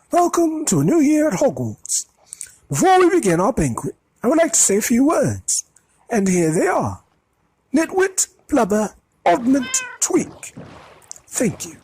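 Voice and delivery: high, loud, grand and merry headmaster; Dumbledore's welcome. A narrator's reading. Dumbledore welcomes the students, grand and merry. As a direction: beam at the hall and boom it out.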